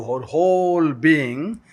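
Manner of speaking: very slow and drawn out; slow recital. Shown as a demonstration. Speaker modelling the line for the class, very slow. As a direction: stretch each word for the listeners. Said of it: "like this, do".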